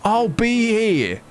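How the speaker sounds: high, chirpy British shopkeeper; cheeky British accent. Acted. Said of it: "I guess he's more like".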